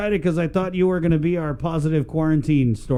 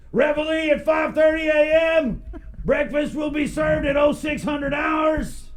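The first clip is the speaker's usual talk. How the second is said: military announcement; 12 semitones higher, slower than usual. Retold or imitated. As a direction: call it like a camp guard. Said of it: "giving them the four one one".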